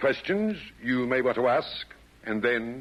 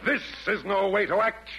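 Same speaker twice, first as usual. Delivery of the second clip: calling for order; 10 semitones higher than usual. Acted. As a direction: cut through the squabble. Chairman halts the brawl.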